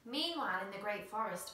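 ordinary delivery

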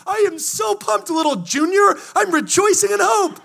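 very high, loud exultant cry; hammed-up jubilation, high and booming; pumped-up rejoicing. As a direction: shout it to the kid with over-the-top joy. Acts pumped parent to kid.

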